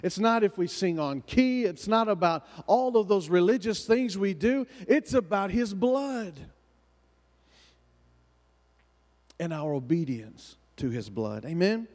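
rising, fervent preacher cadence; preaching. Acted. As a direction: preach it, building in pitch. Preaching build-up on the blood.

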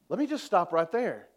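ordinary talk

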